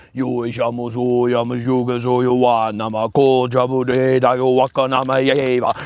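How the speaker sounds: low chanted gibberish light codes; angel voice. Pretend. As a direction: chant the nonsense syllables low. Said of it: "That's my angel voice".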